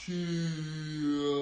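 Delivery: long drawn-out karate cry; slow-motion kiai. Shown as a demonstration. Coach punching in slow motion with kids, slow-motion kiai. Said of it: "I like that slow motion key out".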